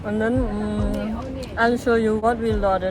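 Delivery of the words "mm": usual speaking voice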